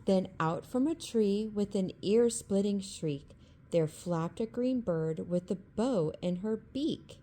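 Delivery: ordinary delivery, nothing marked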